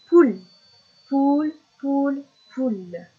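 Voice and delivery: "Poule" in loud, separate beats, last one dropping; whole word repeated. Shown as a demonstration. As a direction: drill "poule" whole for a learner. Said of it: "je répète le mot en entier".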